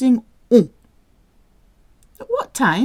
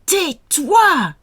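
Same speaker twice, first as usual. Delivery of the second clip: loud, higher snapped order; sharp command; 9 semitones higher, 5 dB louder than usual. Shown as a demonstration. Teacher repeating an angry shut up for learners. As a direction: snap it at someone annoying.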